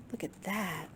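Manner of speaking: usual speaking voice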